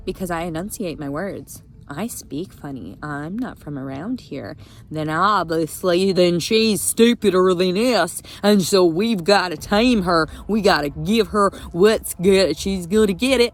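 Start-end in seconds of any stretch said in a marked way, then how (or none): 4.9-13.5 loud heated rant, voice raised and pressing; angry rant. Real, not acted. Vlogger ranting at the court over her custody papers, angry and loud. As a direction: hammer every point home, furious and loud. Ranting about Southern officers.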